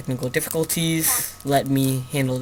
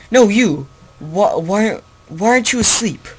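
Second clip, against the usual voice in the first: louder and higher, a half-acted demanding question; startled dad's demand; 6 semitones higher, 7 dB louder than usual. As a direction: imitate a dad catching a kid up at night. Dad startled, demands why awake.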